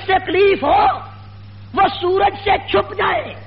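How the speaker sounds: slow, high-pitched oratory to a crowd; impassioned preaching. Real, not acted. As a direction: draw out each phrase like a rally preacher. (بلند سُر میں ٹھہر ٹھہر کر جوشیلی تقریر)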